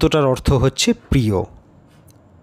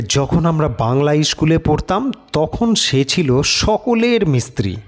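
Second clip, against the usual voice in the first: plain reading voice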